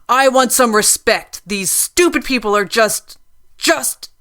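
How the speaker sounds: loud, high, spluttering with rage; rancorous outburst. A narrator's reading. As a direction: choke on your own fury. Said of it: "inarticulate with rancor".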